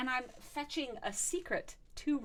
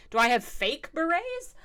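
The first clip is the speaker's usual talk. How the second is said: usual speaking voice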